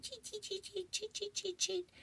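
tiny high, soft bird chirps; Woodstock. Acted. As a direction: cheep like a little bird. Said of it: "is the little bird".

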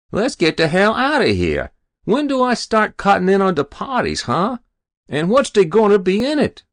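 high, loud, brash demands; impatient lout. A narrator's reading. Hero pressing officials for his share, impatiently.

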